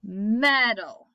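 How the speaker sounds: "Metal" leaning on "Me", ending light; stress on first syllable. Shown as a demonstration. Teacher repeating the right stress of "Metal" to students. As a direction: model "Metal" with a strong first beat. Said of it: "put my emphasis".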